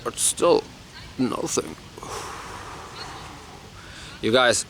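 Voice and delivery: ordinary talk, nothing marked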